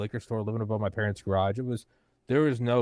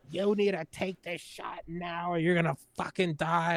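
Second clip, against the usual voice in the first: mocking preachy commenter; 9 semitones higher, 3 dB quieter than usual. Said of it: "other people would be like".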